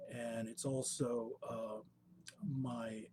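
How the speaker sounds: usual speaking voice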